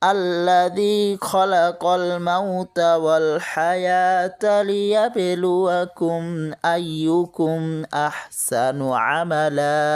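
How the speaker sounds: slow, drawn-out melodic Quran chant; Quranic recitation. Shown as a demonstration. Teacher reciting the verse for students, slow melodic tilawat. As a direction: recite it slowly like tilawat, stretching each vowel.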